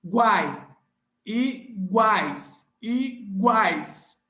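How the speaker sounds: Paraguai slow and loud, guai in one sound; teacher drills guai loudly; all together. Shown as a demonstration. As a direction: stress guai as one glide. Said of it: "tudo junto".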